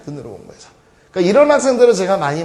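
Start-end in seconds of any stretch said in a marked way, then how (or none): 0.1-0.7 눈으로 공부해서 repeated softly, trailing off. Shown as a demonstration. Teacher quietly repeats his point to the class, trailing off softly. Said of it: "teacher repeats point".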